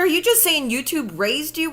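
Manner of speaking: normal talking voice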